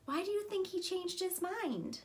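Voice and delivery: usual speaking voice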